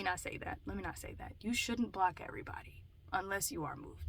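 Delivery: voiceless whisper aside; whispered self-correction. Real, not acted. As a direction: back off the claim in a whisper. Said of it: "whispered caveat".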